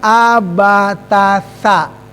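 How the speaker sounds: "Abatasa" slow, each sa clear; teacher sounding out letters; slow spelling out. Shown as a demonstration. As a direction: stretch out "Abatasa" syllable by syllable. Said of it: "Ada sa".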